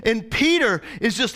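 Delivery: ordinary talk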